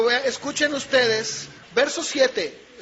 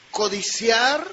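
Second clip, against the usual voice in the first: ordinary delivery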